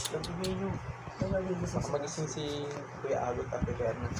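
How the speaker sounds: quiet at night. Real, not acted. Keeping voice down so nobody wakes.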